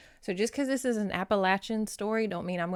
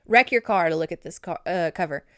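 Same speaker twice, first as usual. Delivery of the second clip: loud joke; 8 dB louder than usual. Real, not acted. Joking to driving viewers.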